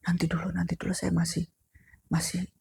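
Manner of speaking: soft whispered stammer; shaken whisper. Retold or imitated. Shaken ambulance driver asking to wait, whispered. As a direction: whisper it, still in shock.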